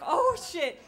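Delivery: loud, high "oh shit"; shocked reaction. Retold or imitated. Shocked reaction to her answer.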